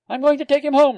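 put-on high, loud child's insistence; a small boy putting his foot down; determined small boy. A narrator's reading. Small boy repeating to his nurse, high and determined.

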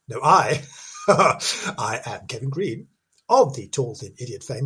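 ordinary talk

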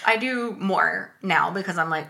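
ordinary talk, nothing marked

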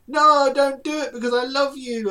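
high, loud, hammed-up plea; mock pleading heroine. Friend mocking the heroine's line, hammed-up and high. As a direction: overact the love declaration. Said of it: "then she's like".

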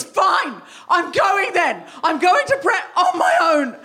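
a hangry wife yelling at her husband before a show; angry shout. Retold or imitated. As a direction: storm out yelling. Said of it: "the way I said it was".

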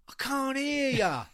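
high, drawn-out stage holler; loud MC taunt, stretched out; goading the crowd. Acted. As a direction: holler it slowly to the back rows.

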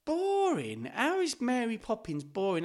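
high disbelieving echo of boring; can't believe what he just heard; outraged repeat of a friend's word; incredulous. Real, not acted. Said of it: "a bit boring".

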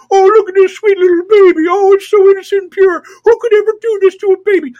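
put-on high, loud, wailing coo over a baby; hammed-up tearful gushing, mocking the baby-cradlers; mocking pro-lifer. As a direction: squeal in fake weepy adoration. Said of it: "with assholes cradling a newborn baby and going".

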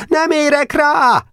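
high, stretched yell over the shoulder; shouting back. A narrator's reading. Hurried boy refusing the chairman, shouting back high. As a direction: yell it back while hurrying off. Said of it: "kiáltott vissza Nemecsek".

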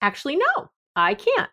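high-pitched, polite acted refusal; boundary-holding. Coach modelling keeping a boundary, politely refusing. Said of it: "keep your boundary and say".

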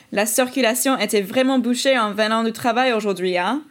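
fluent French with a questioning "hein" tag; French pronunciation. Shown as a demonstration. Translating a traffic complaint for listeners, spoken in French. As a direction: say it in French, ending on nasal "hein". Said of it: "And then in French".

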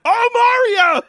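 high, loud gleeful squeal; excited squeal. Retold or imitated. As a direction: squeal with fan delight. Retold fan excitement.

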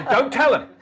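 mock telling-off in a high mum voice; scolding mum. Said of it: "Mum would say".

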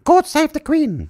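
high, slow royal sign-off; high, drawn-out regal God save the Queen; mock Queen voice. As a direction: bid farewell as the Queen.

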